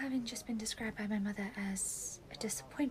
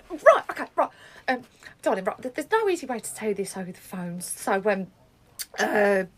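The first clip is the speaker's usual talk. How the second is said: flustered hesitation; 3 semitones lower, 11 dB louder than usual. Acted. Flustered bad news on phone.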